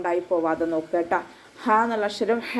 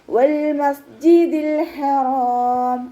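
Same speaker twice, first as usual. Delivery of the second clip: slow, drawn-out, higher chanted recitation; Quran recitation; 5 semitones higher, 5 dB louder, much slower than usual. Shown as a demonstration. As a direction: recite it slowly with tajweed. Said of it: "ചുണ്ടുകളെ circle ചെയ്ത്".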